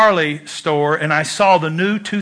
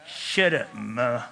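mumbled back-talk; 8 semitones lower, 11 dB quieter, slower than usual. Retold or imitated. Teen mutters back at mother.

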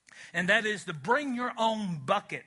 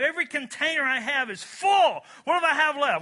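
higher and louder to a shout; building excited shout; 8 semitones higher, 7 dB louder than usual. Acted. Preacher to congregation, building to a shout. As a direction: pile it up until you're shouting. Preacher acting out overflowing blessing.

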